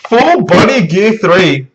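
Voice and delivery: high, slow awestruck exclamation; swooping, stretched-out astonishment; amazed excitement. Real, not acted. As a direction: draw out each word in hyped amazement. Gasping at full reveal.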